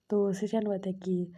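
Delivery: normal talking voice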